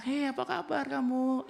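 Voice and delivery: put-on high, slow sing-song greeting to children; talking to kids. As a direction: coo sweetly at a small child. Pastor greets children at church.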